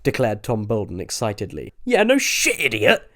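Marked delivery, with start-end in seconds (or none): 1.8-3.1 hissed. A narrator's reading. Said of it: "hissed Milton".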